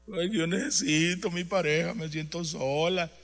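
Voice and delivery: slow, whiny mock lament; lightly voiced complaining wife, drawn out; mock lonely wife. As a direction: mimic a self-pitying abandoned woman. Preacher mocks a lonely wife.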